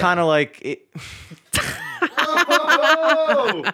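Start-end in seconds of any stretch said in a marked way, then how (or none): 2.3-3.6 high startled laugh; surprised. Real, not acted. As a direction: exclaim in mock shock. Mock alarm at catching it.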